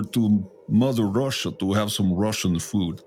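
a light put-on Russian accent. Cohost jokes about chupacabra in a mild Russian accent. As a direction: play up a hint of a Russian accent. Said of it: "Man, what a great accent that was".

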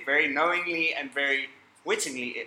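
usual speaking voice

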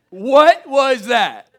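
high, loud and drawn-out disbelief; the same question slowed right down in amazement; slow stunned repeat. Retold or imitated. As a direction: stretch each word in shock. Shocked kid questioning his parents.

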